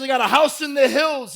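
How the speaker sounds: mock boasting, high and loud; imitation of a puffed-up show-off; loud bragging. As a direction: brag it out at full voice. [name] bragging loudly.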